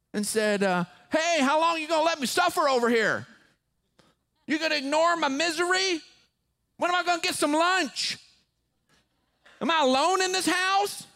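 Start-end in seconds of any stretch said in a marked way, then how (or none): 1.1-11.0 loud, high-pitched whining demands; demanding kid. Acted. Preacher playing a kid complaining to a parent, loud and demanding. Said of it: "if your kid walked up to you".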